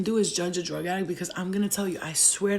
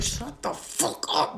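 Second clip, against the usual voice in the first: strained whispered snap; 4 semitones higher, much slower than usual. Retold or imitated. Head pain, snapping at friends.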